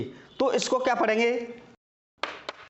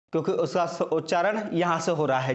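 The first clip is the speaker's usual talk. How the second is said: ordinary delivery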